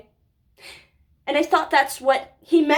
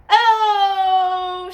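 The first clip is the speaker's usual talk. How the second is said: louder, tearful gasp; choking up; 4 semitones higher, 6 dB louder than usual. Real, not acted. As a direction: catch yourself as tears come. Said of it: "We're not gonna cry".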